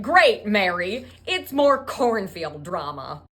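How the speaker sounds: slow, loud sarcastic complaint; mock-fed-up dad, hitting it hard; hammed-up exasperated outburst; loud exasperation. Exasperated father hears of trouble.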